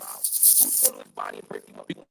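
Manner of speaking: muffled, breaking-up audio; underwater. Real, not acted. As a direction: voice garbling out on a bad line. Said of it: "am I the only person that's having trouble hearing G".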